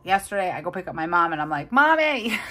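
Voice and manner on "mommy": put-on much higher, louder little-girl call; whiny daughter call. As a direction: call for mom like a small child. Calling mom on the phone.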